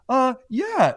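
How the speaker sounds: high, caught-out "uh, yeah"; nervous kid. Retold or imitated. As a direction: squeak out a guilty yes. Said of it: "the kid was like".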